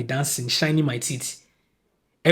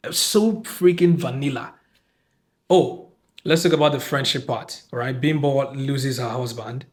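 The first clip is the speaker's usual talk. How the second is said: ordinary talk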